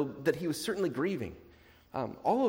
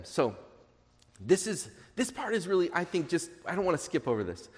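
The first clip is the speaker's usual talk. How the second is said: usual speaking voice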